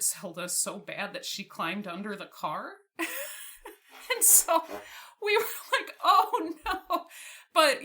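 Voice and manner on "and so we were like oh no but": laughing, much higher and louder. Real, not acted. Retelling the dog hiding under the car, laughing. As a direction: laugh it out in mock alarm.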